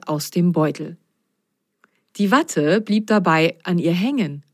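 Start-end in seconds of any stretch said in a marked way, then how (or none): none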